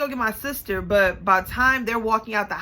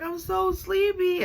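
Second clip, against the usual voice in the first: put-on higher, slow whimper of a sleepy kid; whiny crying kid; 10 semitones higher, slower than usual. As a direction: whine it like a tired crying child. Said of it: "so she crying and she's like".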